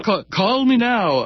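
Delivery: high, slow, playful call me now; drawn-out plea. Acted. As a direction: stretch out call me now like a teasing appeal. Fundraising host pleads for pledges.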